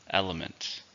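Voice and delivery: "Element" stressed on EL, falling away softly; stress on first syllable. Shown as a demonstration. Teacher models the word for learners, stressing EL in "Element". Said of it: "stress is on the first syllable".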